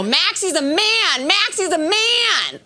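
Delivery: high, drawn-out playground chant; taunting schoolgirls. Retold or imitated. Girls cornering her in the bathroom, mock taunting. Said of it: "girls would corner me in the bathroom and go".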